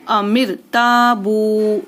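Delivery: "Amir ताबू" with both "ता" and "बू" held long; stretched long vowels. Shown as a demonstration. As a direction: recite slowly, stretching "ताबू" evenly. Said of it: "इनको भी खींचना है". ("ताबू" के दोनों मद खींचकर)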